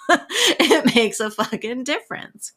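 real laugh, punched and slowed; laughing emphasis. Real, not acted. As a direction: laugh it out while insisting.

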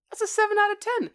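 high, loud excited rating. Real, not acted. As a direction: announce it with a lift.

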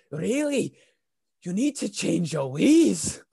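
high, slow, swooping Welsh imitation; Welsh accent. Stranger urges customer to reform, put-on Welsh accent. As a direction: preach it in a drawn-out Welsh voice. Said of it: "he was Welsh".

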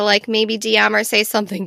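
ordinary talk

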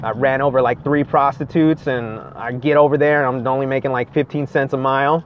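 normal talking voice

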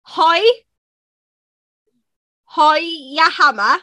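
Geordie accent, word by word with long gaps; Geordie demo. Shown as a demonstration. Teaching the hammer line bit by bit in Geordie accent. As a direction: break the Geordie phrase up for a learner. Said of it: "do the one about the hammer".

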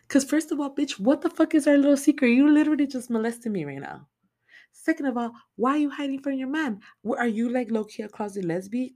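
high, loud outraged rant; indignant high rant. Real, not acted. Speaker ranting at the grandma, outraged and high. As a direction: tell the creep off, scandalized.

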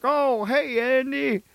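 high, chirpy friendly cop voice; folksy small-town cop. Retold or imitated. Cop waving at townsfolk, in a high, cheerful folksy voice. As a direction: play the cheery small-town sheriff greeting everyone.

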